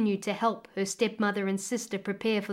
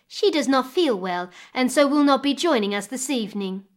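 higher, louder, smoothly false excuse; lying; 4 semitones higher, 6 dB louder than usual. A narrator's reading. Stepmother excuses Damura's absence to father, falsely concerned. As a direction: offer the excuse with mock concern. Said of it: "lied the stepmother".

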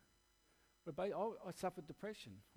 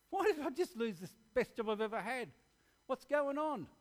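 higher, louder bewildered questions; anguished questioning; 7 semitones higher, 5 dB louder than usual. Retold or imitated. Preacher reliving his own confused questions after losing the job. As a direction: cry out in confusion.

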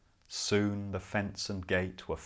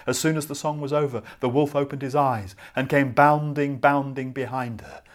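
fast, louder chase narration; excited narration; 3 semitones higher, 7 dB louder, faster than usual. A narrator's reading. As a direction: rush it like the wolf pounces. Wolf chases the girl.

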